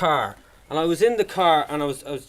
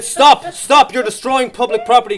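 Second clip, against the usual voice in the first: higher and louder, barking stop stop; mock police shout; 5 semitones higher, 9 dB louder than usual. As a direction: shout it like a cop catching a vandal. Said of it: "He said".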